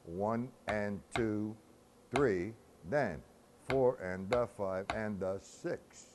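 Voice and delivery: slow, evenly measured count; drawn-out rhythmic counting; breakdown count. Shown as a demonstration. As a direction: count out each beat slowly, in strict time. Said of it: "I'm gonna break it down".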